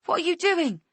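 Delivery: a high, loud shriek. Acted. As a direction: shriek in panicked outrage. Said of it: "Angela shrieks".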